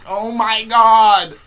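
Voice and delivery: very loud, drawn-out high cry; hysterical exclamation. Retold or imitated. Student drooling with laughter in class, hysterical exclamation. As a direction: holler it slowly, helpless with amusement. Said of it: "drooling, laughing".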